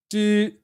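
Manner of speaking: "du" held on one high, even pitch; level tone. Shown as a demonstration. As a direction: hold "du" high without any slide. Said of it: "there's different pitches".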